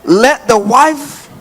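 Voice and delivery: very slow, high drawn-out scripture read. Real, not acted. Preacher reading scripture to church, slow and high. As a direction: read the verse slowly. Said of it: "I'm just gonna read the thing".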